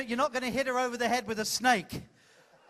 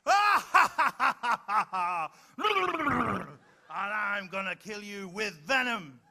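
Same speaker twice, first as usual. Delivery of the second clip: pantomime villain. Acted. Host leading the volunteer's pantomime scene, hammed-up villain. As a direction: announce and cackle like a stage baddie. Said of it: "Here we go".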